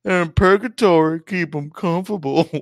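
high, hammed-up character voice; keep 'em comfortable voice. Riffing on purgatory with co-host, in a silly high character voice. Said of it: "I don't know why I was doing the".

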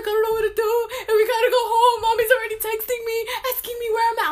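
very high, whiny mock sobbing voice; high-pitched pleading impression; imitated crying sister. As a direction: put on a distressed little sister. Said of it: "she's crying and everything".

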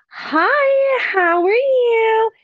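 high, sing-song hello, slowed; customer service voice. Retold or imitated. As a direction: put on the bubbly front-desk voice. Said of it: "when you go into your professional mode".

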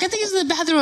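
high-pitched imitation of others; bystanders' answer. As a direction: mimic a bystander filling her in. Acts others answering her.

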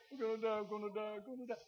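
high, quiet mock whimper; scared to death. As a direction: whimper it over and over in panic. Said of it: "He's the one that's scared to death".

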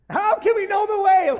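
Thomas freaking out, shouting at Jesus; panicked shout. Acted. Thomas crying out to Jesus in alarm, very high and loud.

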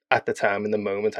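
normal talking voice

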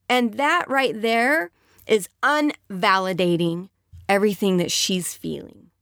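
normal talking voice

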